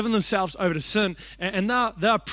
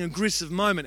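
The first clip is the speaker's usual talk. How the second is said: ordinary delivery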